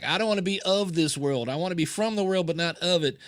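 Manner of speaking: earnest zealot imitated with a Southern accent. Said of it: "he has a Southern accent".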